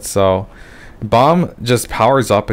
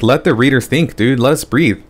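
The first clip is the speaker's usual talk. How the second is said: ordinary talk, nothing marked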